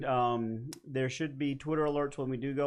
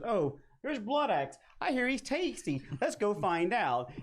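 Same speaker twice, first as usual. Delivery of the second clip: raised, drawn-out eager chatter; lightly voiced spiders; 6 semitones higher than usual. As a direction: plot a snack with mock innocence. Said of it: "every spider in this game goes".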